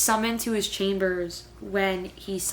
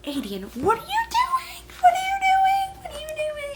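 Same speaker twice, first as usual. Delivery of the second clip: shocked disbelief; 4 dB louder than usual. Real, not acted. Reacting to Aedion's confession.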